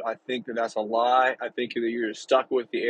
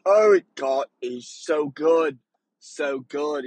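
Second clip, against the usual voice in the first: louder at first, then slowing into a mild mock blubber; tearful drunk gush; 2 semitones higher, 5 dB louder, slower than usual. Drunk stranger at Hamilton gushing in mild mock tears. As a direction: imitate a tipsy Englishman gushing through mock tears. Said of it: "He goes".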